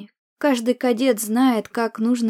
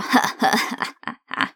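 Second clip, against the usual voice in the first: croaking witch laugh; harsh crow-like cackle; cawing cackle. A narrator's reading. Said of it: "каркающий смех".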